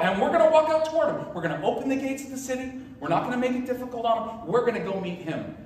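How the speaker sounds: high-pitched, excited briefing to the group; rally them in a high, earnest voice; eager high priest. Acted. High priest telling his people the dream plan, high and eager.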